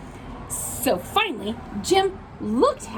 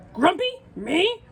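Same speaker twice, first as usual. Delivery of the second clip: indignant denial; 3 semitones higher than usual. Acted. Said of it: "said Jim".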